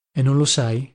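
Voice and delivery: ordinary delivery, nothing marked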